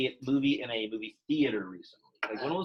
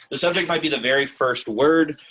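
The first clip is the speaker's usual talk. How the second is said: repeated after a glitch, noticeably louder; a firmer, louder second take; louder retake; 3 semitones higher, 8 dB louder than usual. Shown as a demonstration. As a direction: say it again louder and clearer. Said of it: "I don't know why that happened".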